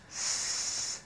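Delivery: "s" as a soft unvoiced hiss; hissed S sound. Shown as a demonstration. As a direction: give just the hiss of "s".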